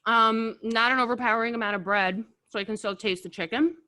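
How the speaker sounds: talking through a mouthful of wings; chewing while speaking; mouth full. Real, not acted. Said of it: "Talking with my mouth full".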